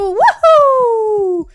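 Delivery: loud high cheer; victory whoop. Real, not acted. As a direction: whoop in triumph. Monster dying, celebrating.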